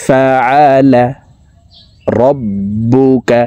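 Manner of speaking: slow, sustained tajweed chant; measured teaching recitation; Quran recitation drill. Shown as a demonstration. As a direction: recite each word drawn out.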